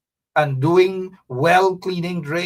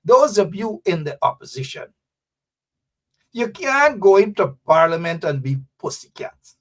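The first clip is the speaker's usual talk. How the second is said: usual speaking voice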